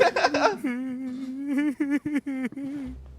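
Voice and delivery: long high giggling; high giggle fit. Real, not acted. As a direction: let a giggle fit run on. Laughing after bean throw.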